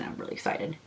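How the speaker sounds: breathy excited whisper. Real, not acted. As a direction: whisper it like a secret thrill. Gushing about ordered lipsticks.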